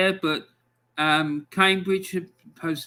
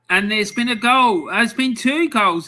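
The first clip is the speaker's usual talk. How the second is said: higher and louder, real goal excitement; excited; 5 semitones higher, 6 dB louder than usual. Real, not acted. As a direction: burst in with the news of a goal. Goal alert during live scores.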